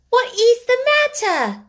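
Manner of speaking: high, loud schoolgirl cry; excited, high-pitched question. A narrator's reading. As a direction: call out in urgent curiosity. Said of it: "cried two or three voices".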